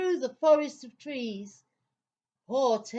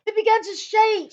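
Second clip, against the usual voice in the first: high, loud, fast narration; thrilling storyteller shout; dramatic build; 11 semitones higher, 11 dB louder, faster than usual. A narrator's reading. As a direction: ramp it up as the boat shakes.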